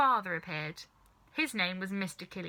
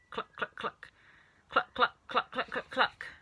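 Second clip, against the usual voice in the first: hen clucking; 3 semitones higher than usual. A narrator's reading.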